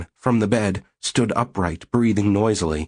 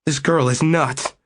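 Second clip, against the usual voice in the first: higher, whining excuse; plaintive whine; 7 semitones higher than usual. Acted. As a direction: whine it to your wife. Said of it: "in a plaintive, whining tone".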